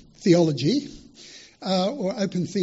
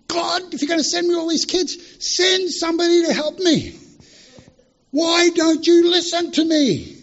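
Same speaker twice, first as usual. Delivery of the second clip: yelling prayer; 10 semitones higher, 4 dB louder than usual. Retold or imitated. Missionary begging God for help each night, yelling. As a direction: call up to heaven, exasperated and pleading. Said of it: "You yell at me every night".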